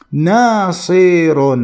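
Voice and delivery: ناصر drawn out slowly, each syllable clear; slow demo of the word. Shown as a demonstration. Teacher to students, showing the letters, slowly. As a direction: stretch ناصر for the class to hear its vowels. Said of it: "لاحظوا".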